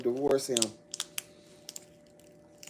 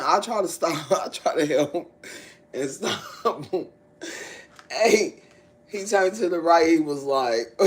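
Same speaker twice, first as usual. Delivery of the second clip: normal talking voice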